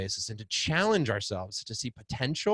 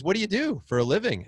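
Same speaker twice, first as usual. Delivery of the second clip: higher curious stranger; 8 semitones higher, 4 dB louder, faster than usual. Retold or imitated. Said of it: "And he goes".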